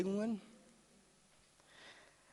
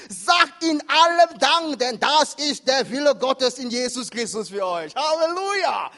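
proclaimed Bible verse; 6 semitones higher, 10 dB louder than usual. Acted. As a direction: declaim the verse word by word.

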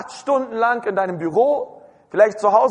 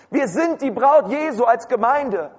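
usual speaking voice

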